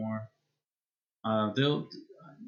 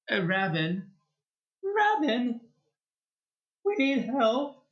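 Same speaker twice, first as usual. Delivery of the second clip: much higher, whiny plea; Friar Tuck's whiny voice; 12 semitones higher, 3 dB louder than usual. Retold or imitated. Gamer mimicking Friar Tuck's voice he dislikes, whiny. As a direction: imitate a whiny friar pleading, high-pitched. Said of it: "whiny voice".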